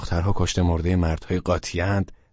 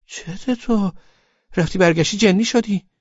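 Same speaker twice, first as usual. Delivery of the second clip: put-on high, puzzled girl voice; girl's voice; 12 semitones higher, 5 dB louder than usual. A narrator's reading. Laleh asking what's wrong with him, high voice. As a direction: ask as the baffled girlfriend. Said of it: "ازم پرسید".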